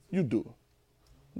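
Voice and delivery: quiet echo of God's words; soft, slow. Acted. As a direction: let it land gently. Quiet restatement of God's line.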